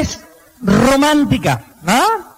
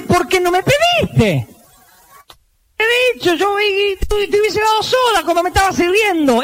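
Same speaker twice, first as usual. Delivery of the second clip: high bewildered husband; clueless husband; 9 semitones higher than usual. Acted. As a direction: play the puzzled husband, pitched up.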